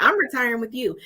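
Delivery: retold comeback to laughing coworkers; insistent retort. Repeats her claim despite laughter.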